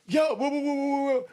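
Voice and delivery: high, loud mock yell down the tier; imitated yelling. Inmate yelling from behind his cell door. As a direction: holler it like a cell gangster interrupting. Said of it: "And he'll just start yelling".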